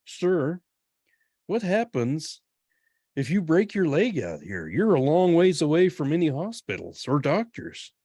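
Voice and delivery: a hint of a worried tourist lady; imitating a lady. Lady tourist's question retold.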